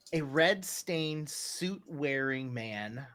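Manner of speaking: ordinary talk, nothing marked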